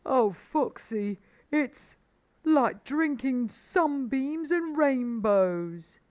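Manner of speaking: high, slow, loud ecstasy; gasped rapture. A narrator's reading. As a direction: pour it out slowly, overcome with delight. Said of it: "he gasped".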